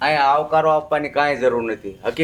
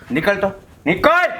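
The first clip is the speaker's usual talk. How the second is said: stern order out; 4 semitones higher than usual. Acted. Father throwing his son out.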